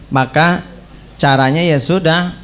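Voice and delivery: normal talking voice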